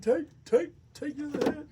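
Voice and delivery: loud cheering chant. Real, not acted. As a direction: egg the drinker on loudly. Urging drinker loudly.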